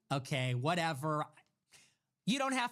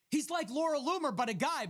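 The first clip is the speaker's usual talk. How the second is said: much higher, sing-song joke delivery; mock tweet voice; 7 semitones higher than usual. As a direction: pitch the punchline up like a jab. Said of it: "I was like".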